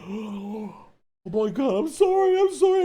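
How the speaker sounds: high, frantic mock apology; apologizing. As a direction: squeak out sorry, caught by his wife. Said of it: "I'm like".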